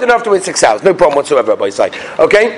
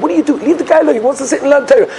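indignant; 6 semitones higher, faster than usual. Retold or imitated. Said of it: "somebody told him".

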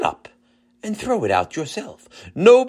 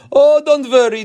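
put-on heavy Israeli accent, higher and louder; 11 semitones higher, 7 dB louder than usual. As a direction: reassure in a thick Israeli accent. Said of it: "in his heavy Israeli accent".

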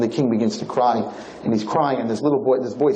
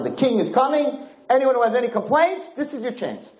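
high, ringing proclamation; town announcement; 12 semitones higher than usual. Retold or imitated. Announcing the king's visit to the whole town, high proclamation. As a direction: proclaim it high, like a royal herald. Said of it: "there's a big announcement in this town".

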